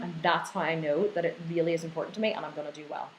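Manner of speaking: normal talking voice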